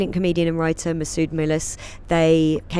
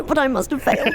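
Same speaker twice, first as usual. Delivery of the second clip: mock anxious inner voice; 8 semitones higher than usual. Imposter syndrome inner voice.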